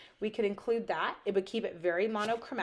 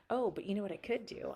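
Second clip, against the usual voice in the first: ordinary delivery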